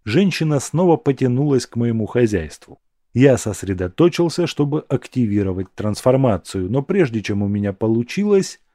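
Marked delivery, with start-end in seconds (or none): none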